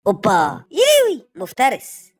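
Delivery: loud, high hype yelling; excited shout. Acted. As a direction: cheer him on like a beast. Loud exclaim at dog.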